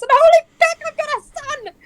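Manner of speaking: very high, loud joyous exclamation; ecstatic cry. Acted. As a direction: shout the news with joy. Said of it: "she's like".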